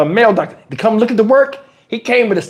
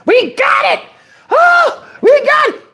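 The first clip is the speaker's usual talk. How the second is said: shrieking dentist celebration; triumphant cheer. Retold or imitated. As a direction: whoop it like you just won. Dentist celebrating pulled tooth.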